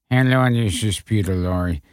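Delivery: a low, lazy stab at Lorre; terrible Peter Lorre. Retold or imitated. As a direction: mumble it like a bad Lorre. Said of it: "terrible Peter Lorre imitation".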